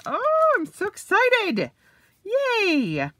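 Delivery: high squealing delight; excited squeal. Real, not acted. Admiring the rust-coloured Fraser, a loud high squeal. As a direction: let it burst up high and loud. Opening the new Fraser cover.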